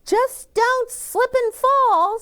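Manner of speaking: a sister poking fun in a high squeaky tone; teasing big sister. A narrator's reading. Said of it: "says my sister".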